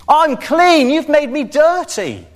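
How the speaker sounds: high, slow, disdainful put-on voice of a self-righteous ruler; religious rulers. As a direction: sneer it like a prim religious leader recoiling. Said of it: "That's what the religious rulers would have said".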